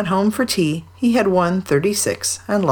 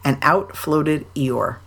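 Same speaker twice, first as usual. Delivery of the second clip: usual speaking voice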